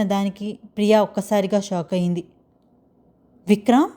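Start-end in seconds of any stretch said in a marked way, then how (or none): 3.5-3.9 surprised. A narrator's reading. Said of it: "అని ఆశ్చర్యంగా".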